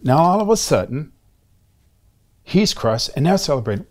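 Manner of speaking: ordinary talk, nothing marked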